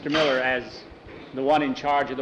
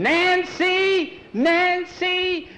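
frantic high scream; 13 semitones higher, slower than usual. A narrator's reading. Mother screaming for her missing daughter, panicked. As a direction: scream for a lost daughter in terror. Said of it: "Missus Miller starts screaming".

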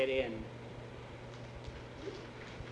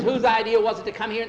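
loud, higher-pitched accusation; raised voice in a squabble; arguing; 9 semitones higher, 11 dB louder than usual. Acted. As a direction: fling the blame back. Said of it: "Now we're fighting".

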